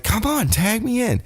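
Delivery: hammed-up impatient demand; imitated pleading yell. Kevin pestering his partner for a tag, imitated yell.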